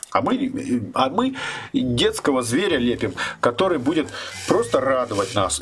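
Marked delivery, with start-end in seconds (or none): none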